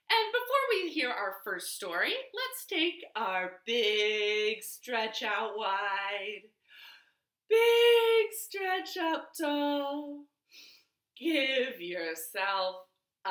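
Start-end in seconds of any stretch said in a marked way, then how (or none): 2.3-10.2 slow drawn-out stretch call; stretch cue. Shown as a demonstration. Librarian leading a stretch, words drawn out.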